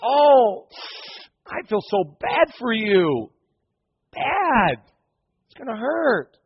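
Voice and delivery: high, slow, pitying voice; mock sympathizer. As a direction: drip with fake pity. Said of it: "That's as far as they get".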